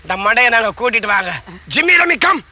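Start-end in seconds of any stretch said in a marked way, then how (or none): 1.7-2.4 higher sing-song call; dog-calling voice. Acted. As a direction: summon the dogs from afar. Calling dogs over.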